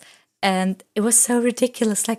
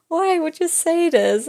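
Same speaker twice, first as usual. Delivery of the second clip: baffled question; 7 semitones higher than usual. Retold or imitated. Retelling a teacher's strange remark.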